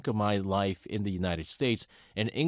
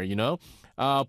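ordinary talk

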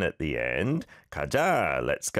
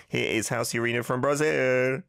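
usual speaking voice

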